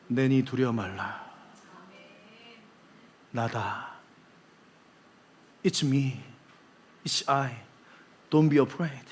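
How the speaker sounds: low, soft, slow comforting; gentle reassurance. Acted. Jesus softly telling frightened disciples not to fear. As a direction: soothe frightened friends.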